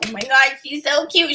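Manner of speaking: usual speaking voice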